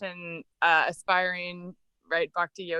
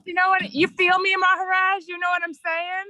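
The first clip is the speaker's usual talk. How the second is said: playful slang; 11 semitones higher, 6 dB louder than usual. Acted. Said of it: "playful slang question to teacher".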